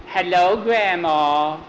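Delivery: put-on slow, drawn-out little-girl hello; stagy greeting. Skeeter entering Grandma's house, stagy sweet greeting. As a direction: recite it sweetly for the stage.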